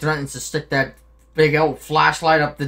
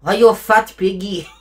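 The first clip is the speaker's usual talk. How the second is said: put-on loud, teasing Eastern European accent; Nico Bellic impression; 5 semitones higher, 8 dB louder than usual. Player as game hero taunting his cousin, Eastern European accent. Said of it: "I want you to do Nico".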